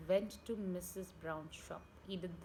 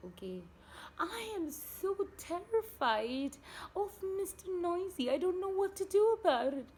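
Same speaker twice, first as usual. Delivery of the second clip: frightened wife; 8 semitones higher, 6 dB louder than usual. A narrator's reading. Mrs Brown voiced in story.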